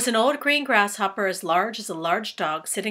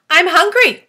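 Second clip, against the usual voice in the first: booming high demand, much louder; loud hungry spider; 10 semitones higher, 13 dB louder than usual. Acted. Spider announcing to James she wants food, booming. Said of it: "the spider announced".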